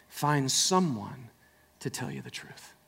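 slow, dropping into a whisper. Acted. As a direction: let the line fade to a hush. Hushed sermon point.

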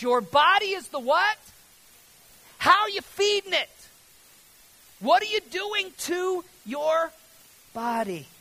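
high, shouted rhetorical questions; preacher's loud challenge. Real, not acted. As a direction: call it out to the whole congregation.

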